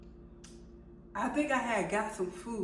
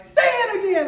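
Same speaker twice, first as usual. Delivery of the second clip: full-volume, high-pitched retold yell; explosive acted-out shout; 12 semitones higher, 13 dB louder than usual. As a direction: yell the order at a child. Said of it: "like I'm yelling at him".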